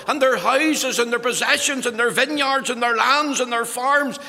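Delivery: impassioned sermon climax; preaching at full force. Acted. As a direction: thunder it from the pulpit.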